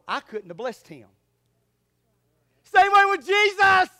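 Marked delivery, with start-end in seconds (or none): 2.6-3.9 high, loud, slow shout; preaching shout. Real, not acted. Preacher shouting to congregation, loud. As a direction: proclaim it loud.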